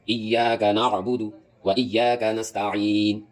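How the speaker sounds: slow "ایاک نعبد و ایاک نستعین" with throaty ع in both verbs; drawn-out recitation, pharyngeal ع in "نعبد" and "نستعین"; ع brought out. Shown as a demonstration. Teacher models the verse, ع clearly brought out. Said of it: "عین نکالے، نعبد میں بھی".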